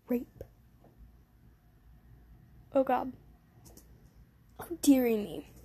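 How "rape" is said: usual speaking voice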